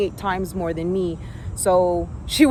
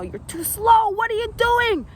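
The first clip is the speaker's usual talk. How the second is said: imitating scolding coach; 11 semitones higher than usual.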